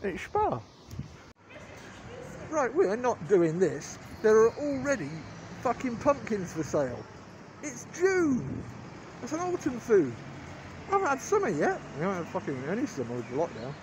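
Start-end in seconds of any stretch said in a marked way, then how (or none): none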